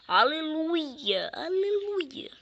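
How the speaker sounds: slow drawn-out sarcastic hallelujah; exasperated aleluia. Real, not acted. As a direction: drag it out, fed up. Frustrated gamer mock praise.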